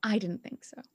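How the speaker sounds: whispered dry retort. Acted. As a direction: breathe it out, unvoiced. Player character answers dryly.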